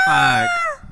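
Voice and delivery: shouting. Real, not acted. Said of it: "I've never heard [name] fuck".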